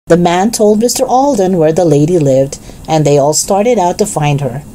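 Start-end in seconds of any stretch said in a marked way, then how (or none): none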